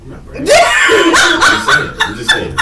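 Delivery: high laughing protest. Real, not acted. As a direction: protest while cracking up.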